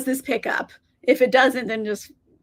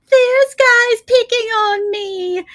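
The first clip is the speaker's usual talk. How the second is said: much higher, slow, whiny voice of a bullied nerd; mock whiny plea; 10 semitones higher, 5 dB louder, much slower than usual. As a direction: play up the complaint like a victim. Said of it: "Dimitri writes him a letter saying".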